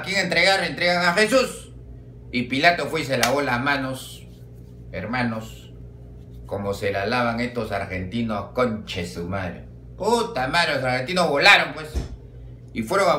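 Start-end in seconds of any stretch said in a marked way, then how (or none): none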